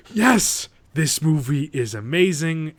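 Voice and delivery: loud high "yes", then emphatic slow praise; elated cheer. Retold or imitated. Viewer cheering the sledgehammer scene, elated. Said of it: "and you're like".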